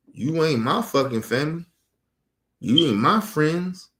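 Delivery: ordinary delivery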